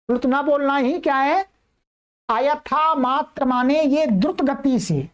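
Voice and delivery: ordinary talk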